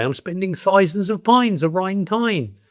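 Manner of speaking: high, slow mock-royal accent; hammed-up, high-pitched upper-class English; Queen's English. Shown as a demonstration. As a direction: imitate the Queen with "pines" for pounds and "tine" for town. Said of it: "And the Queen would say".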